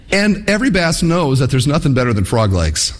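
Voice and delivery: ordinary talk, nothing marked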